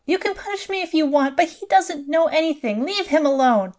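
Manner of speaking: a frightened mother pleading; high, desperate begging. A narrator's reading. Madeline begs for her son.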